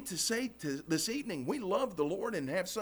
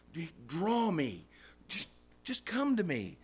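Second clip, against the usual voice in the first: tender invitation. Pretend. God's invitation voiced.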